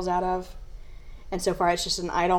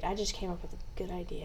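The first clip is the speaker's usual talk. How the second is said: whispering; 11 dB quieter than usual. Real, not acted. Hushed aside about idea.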